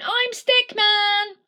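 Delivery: high, slow, loud shout of his own name; proud proclamation. Acted. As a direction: announce yourself proudly to the world.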